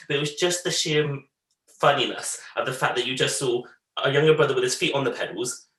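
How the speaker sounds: ordinary talk, nothing marked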